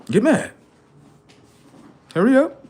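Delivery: high taunting call. Real, not acted. Streamer goading chat to get mad, high taunt. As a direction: goad them, high and loud.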